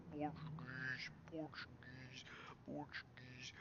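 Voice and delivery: soft, breathy murmuring chant; soft repetition. Pretend. Streamer murmuring chat's word to herself, softly.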